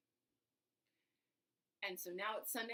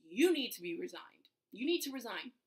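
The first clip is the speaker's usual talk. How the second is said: loud, pressing repetition of "resign"; insistent; 2 semitones higher, 10 dB louder than usual. Retold or imitated. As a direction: push it on her like a stern boss. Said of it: "basically is like".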